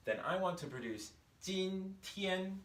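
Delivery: "今天" pitched high above the mid-range. Shown as a demonstration. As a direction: lift "今天" high and keep it flat. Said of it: "higher, up here".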